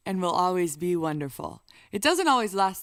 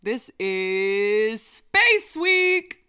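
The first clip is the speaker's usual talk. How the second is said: loud, very slow announcement; excited; 2 semitones higher, 7 dB louder, much slower than usual. Real, not acted. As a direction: proclaim it grandly. Announcing his new song.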